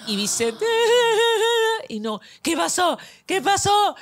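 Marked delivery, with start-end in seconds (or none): 0.7-1.8 high, loud mock bawling; drawn-out high mock cry; imitated child's wail. Imitates hurt child wailing. 2.4-3.9 high, urgent retold cry; raised-pitch imitation of alarm; alarmed mother. Alarmed mother rushing to hurt child.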